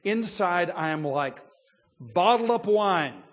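slow, loud and high, bottled-up pressure; ready to burst. Retold or imitated. The preacher voicing Elihu's bursting pride, loud and slow. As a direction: let it swell like fermenting wine. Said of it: "look how he describes it".

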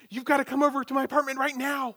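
high, loud imitation of panicked mother; panicked mom. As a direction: mimic mom in a phone panic. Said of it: "I hear this panicked voice on the other end".

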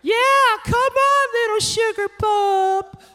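high cooing cheer from the stands; mom cheering. Retold or imitated. Mom in the stands cheering her son, high and cooing. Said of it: "my mom's back there".

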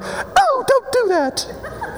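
high, slow falsetto whimper; hammed-up helpless squeal at being tempted; feeble mock protest. Mocking feeble swatting at sin.

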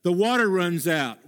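usual speaking voice

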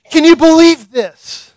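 high, loud, slowed-down amazed cry; amazed exclamation. Retold or imitated. Fishermen in a vision marvelling at their catch, loud and high. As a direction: gasp it out in wonder.

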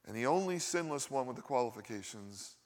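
ordinary delivery

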